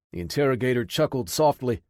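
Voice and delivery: ordinary delivery, nothing marked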